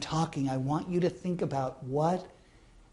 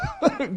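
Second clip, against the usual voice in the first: dad's laugh; 11 semitones higher than usual. Real, not acted. Dad laughing at haircut.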